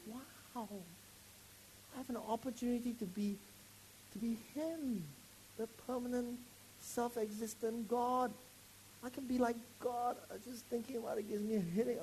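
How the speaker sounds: soft, high, tearful voice; overwhelmed, on the edge of crying; tearful awe. Real, not acted. Preacher overcome thinking he can be like God. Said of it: "I wanna cry for a long time".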